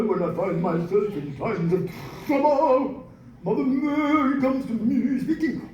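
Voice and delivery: a hint of an over-enunciated choir voice; mock classical enunciation. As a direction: lightly send up a classically trained singer. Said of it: "you enunciate".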